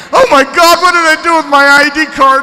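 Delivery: high, frantic, rushed panic; a student in a morning panic, voice shooting up; panicked. Pretend. Teacher acting out a panicked student, frantic and high.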